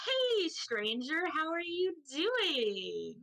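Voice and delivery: high, drawn-out awkward greeting; socially awkward Bath. Acted. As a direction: overdo a slow, awkward hello. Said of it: "Bath is very clearly socially awkward".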